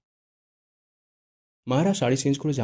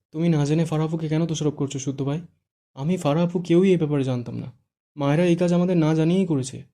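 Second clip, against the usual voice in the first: upset protest; 5 semitones higher, 5 dB louder than usual. Acted. Inaya defends Fara to Shuddho.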